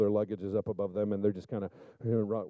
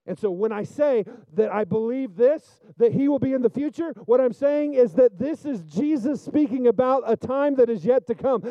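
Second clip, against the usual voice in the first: higher and louder, soaring sermon voice; preaching at full force; 11 semitones higher, 7 dB louder, slower than usual. Acted. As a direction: preach it loud and fervent to the congregation.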